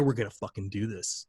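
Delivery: low, hushed confiding line; soft, secretive imitation of whispering; retold whisper. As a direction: drop it down, as if into his ear. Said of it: "whispered in his ear".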